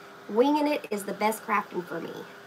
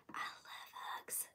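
whisper; 21 dB quieter, slower than usual. Real, not acted. Reading a viewer's comment aloud.

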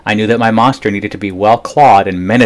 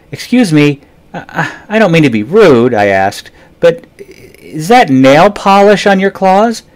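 put-on higher, louder, halting boy asking timidly; nervous polite question; 10 semitones higher, 6 dB louder than usual. A narrator's reading. Boy asks Ralph about his nail polish, high and startled. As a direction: stammer out a shocked, polite question.

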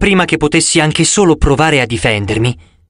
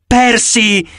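pleading; 8 semitones higher than usual. A narrator's reading. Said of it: "supplicò Chirone".

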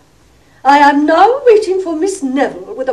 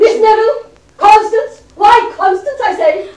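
calling out; 6 semitones higher than usual. Acted. Mother calls Constance from afar.

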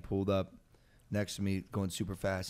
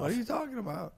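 incredulous yelp; 9 semitones higher, 9 dB quieter than usual. Real, not acted. Reacting to mockery.

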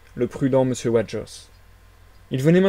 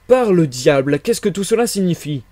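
higher-pitched irritated question, opening loud; 5 semitones higher, 5 dB louder than usual. A narrator's reading. Headless stranger confronting the men who burst in, irritated. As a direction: demand an explanation with annoyance. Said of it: "la question irritée".

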